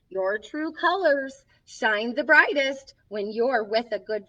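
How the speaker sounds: gentle picture-book reading; reading to children. Acted. Storybook host reading a friendship book to kids, gently. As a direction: read each page to the little ones.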